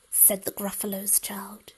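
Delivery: plain reading voice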